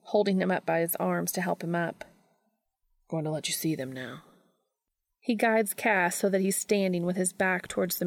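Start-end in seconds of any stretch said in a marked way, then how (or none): 3.1-4.2 a low hush of reassurance; quietly coaxing his bound partner; soft and low. A narrator's reading. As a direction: ease him into it softly. Dean softly reassuring bound Cass.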